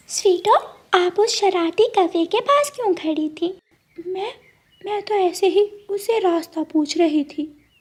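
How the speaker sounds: a hint of a worried friend; suspicious sparrow. Gudiya asking the goat why she stood by the crow.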